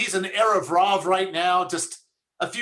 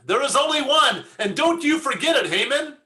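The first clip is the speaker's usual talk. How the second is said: higher-pitched, hammed-up royal decree; mock stern warning; 7 semitones higher than usual. Persian king warning Haman in a joke, mock stern. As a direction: lay down the law in mock indignation.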